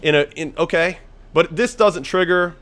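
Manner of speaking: ordinary talk, nothing marked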